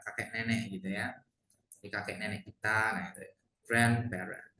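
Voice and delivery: usual speaking voice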